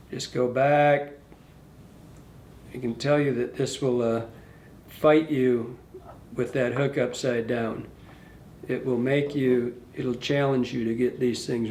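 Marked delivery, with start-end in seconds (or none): none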